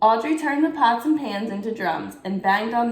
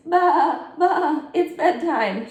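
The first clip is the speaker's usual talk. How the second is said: high, slow bleating sheep with a laugh; playful sheep baa, slowly; 8 semitones higher, 3 dB louder, much slower than usual. Acted. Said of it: "made a shadow sheep".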